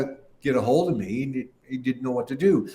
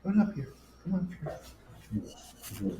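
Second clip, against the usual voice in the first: soft coaxing; 5 semitones higher, 10 dB quieter than usual. Real, not acted. Coaxing dog softly.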